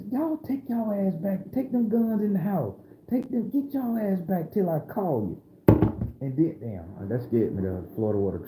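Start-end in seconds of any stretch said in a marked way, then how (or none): 0.1-5.4 Grandma giving orders. Retold or imitated. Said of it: "Grandma was pointing at".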